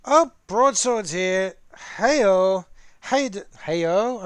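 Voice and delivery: booming, drawn-out hello to a friend; loud excited greeting. Real, not acted. Greeting Broadsword as he joins, too loud. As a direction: call out the welcome big and slow. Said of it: "I'm so sorry I did that".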